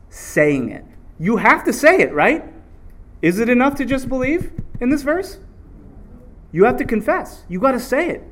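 ordinary talk

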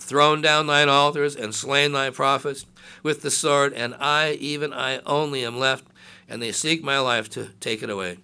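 loud, declaiming Bible reading; scripture reading. Acted. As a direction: proclaim the verses to the congregation. Reads scripture aloud.

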